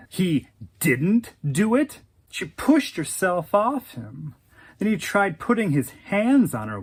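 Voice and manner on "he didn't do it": slow disbelieving question, rising at the end; incredulous echo. Acted. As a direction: throw his claim back as a question. She echoes him in disbelief.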